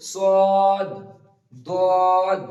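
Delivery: সদ and দদ said loud with the tongue edge on the gums; firm, heavy সদ then দদ; emphatic Arabic sad and dad. Shown as a demonstration. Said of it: "আপনি আবারও বলুন". (জোরে, জিভের কিনারা মাড়িতে লাগিয়ে সদ, দদ)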